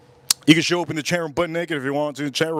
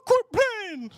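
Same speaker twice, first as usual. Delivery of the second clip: very high, drawn-out falsetto; stripper imitation; slower than usual. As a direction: imitate her in a slow, squeaky-high voice. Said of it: "She goes".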